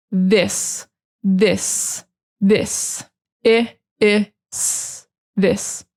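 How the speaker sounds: this with short I and soft hissing S; teacher models this for students. Shown as a demonstration. Teacher drills short I and soft S. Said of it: "short I sound ... soft S".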